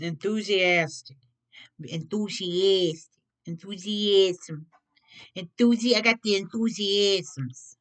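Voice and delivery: lightly squeaky rodent voice; squeaky rat voice. Pretend. Card reader toys with the word in a mock rat voice. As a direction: play with the word like a little rat. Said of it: "almost sounds like a rat".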